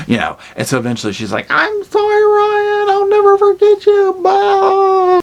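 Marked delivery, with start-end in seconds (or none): 1.5-5.2 very high, loud falsetto goodbye; mock dramatic farewell. Heroine bidding lover Ryan goodbye, hammed-up falsetto. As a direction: ham up a tragic, melodramatic farewell.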